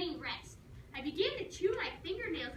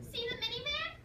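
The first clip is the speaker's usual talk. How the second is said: much higher nagging mom question; 9 semitones higher than usual. Retold or imitated. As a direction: mimic mom pointing things out. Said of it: "that voice was boring into my brain".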